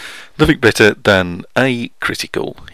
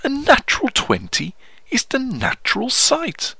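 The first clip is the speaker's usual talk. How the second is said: posh poetry voice; 3 semitones higher than usual. Acted. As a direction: declaim comic verse in a put-on posh voice. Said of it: "It's my poetry voice".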